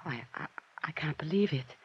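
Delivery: a shocked whisper on hearing of the murder; stunned whisper. Acted. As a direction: breathe the name in disbelief.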